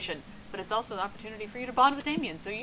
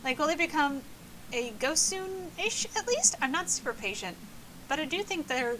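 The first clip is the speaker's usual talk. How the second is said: put-on higher chirpy party girl voice; bubbly ghost girl; 4 semitones higher than usual. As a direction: gush like a lovestruck ghost. Polly voice continues.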